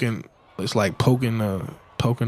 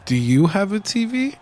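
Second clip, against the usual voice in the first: put-on girly high voice; 8 semitones higher than usual. Girl voice quoted, high.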